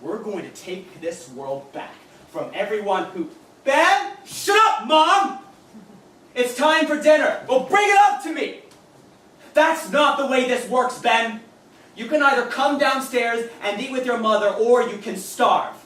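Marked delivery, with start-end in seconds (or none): 3.6-5.4 much higher and louder, snapping; irritated yell. Acted. Mother interrupts, he yells. 6.3-7.4 higher and louder, calling upstairs; mother calling up. Acted. 7.5-8.6 much higher and louder, demanding; whine-shout at mom; bratty yell. Acted. Son yells demand. 9.5-15.8 louder and firm, scolding; stern mother. Acted.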